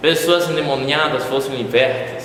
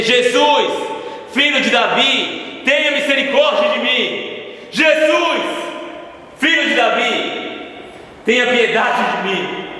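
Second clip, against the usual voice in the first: desperate shout; 9 semitones higher than usual. Acted. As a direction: shout over the crowd, begging for mercy. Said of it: "Eu gritei".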